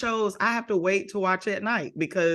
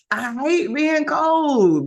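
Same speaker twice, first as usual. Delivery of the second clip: mock whine; 8 semitones higher, 4 dB louder, slower than usual. Real, not acted. Whining about cold.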